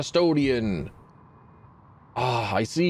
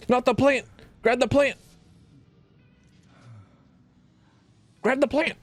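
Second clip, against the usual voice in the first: rushed, desperate shouts; frantic yelling; 10 semitones higher than usual. Real, not acted. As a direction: yell at the screen to save the plant. Frantic about the plant in-game.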